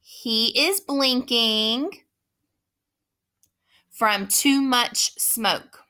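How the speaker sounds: very slow, every word apart; slow dictation. Shown as a demonstration. Teacher reading a dictation sentence aloud slowly. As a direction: read it slowly for the class to repeat. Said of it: "I'm gonna read the whole sentence".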